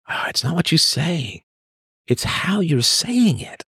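high, soft imitation of a chiding woman; mock tone-policing woman. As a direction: mimic a woman gently scolding, drawing out the end. Voices women tone policing him.

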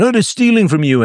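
mocking populist. Mocking populists stirring up crowds.

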